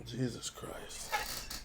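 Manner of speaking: slow, voiceless muttered oath; hushed, drawn-out disbelief; appalled whisper. Real, not acted. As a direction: whisper it slowly in dismay. Appalled by the schedule.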